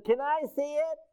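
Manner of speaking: put-on high, soft little girl's voice; a small girl politely asking to see it; little sister asking. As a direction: sister asks to see the lock, in a high childlike voice. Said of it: "my sister said".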